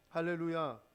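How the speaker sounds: normal talking voice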